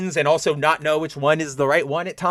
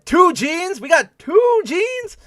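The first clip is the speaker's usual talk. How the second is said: slow, much higher incredulous question; surprised disbelief; 12 semitones higher, 4 dB louder, much slower than usual. Real, not acted. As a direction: drag out the shock at seeing double. Reacting to two Jeans on screen.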